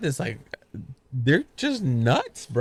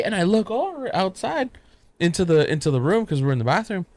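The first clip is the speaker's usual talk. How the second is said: normal talking voice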